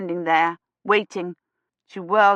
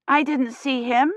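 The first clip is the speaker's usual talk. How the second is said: put-on child voice; 7 semitones higher than usual. A narrator's reading.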